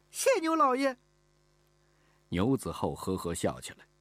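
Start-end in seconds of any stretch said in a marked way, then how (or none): 0.1-1.0 put-on very high, loud child's cry; overjoyed. Girl thanks the master for letting her study, overjoyed. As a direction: burst out with delighted thanks as a little girl. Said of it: "高兴地跳了起来".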